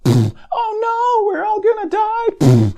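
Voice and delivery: panicked screaming. Acted. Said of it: "stupid Hollywood trailers".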